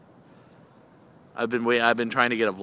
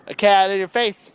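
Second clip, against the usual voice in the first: high, loud playful announcement; playful exclaiming; 8 semitones higher, 8 dB louder than usual. Pretend. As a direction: belt it out high, in fun. Repeats playful cat line louder.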